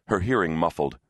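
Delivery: ordinary delivery, nothing marked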